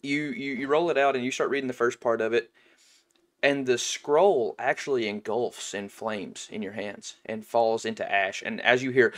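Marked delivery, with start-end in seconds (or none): none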